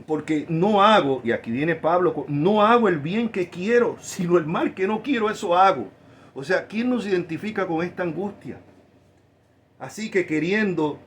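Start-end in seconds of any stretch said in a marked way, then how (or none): 0.1-5.9 preaching scripture. Acted. Reads Pablo, loud and slow. (lectura de la escritura predicada con fuerza y despacio)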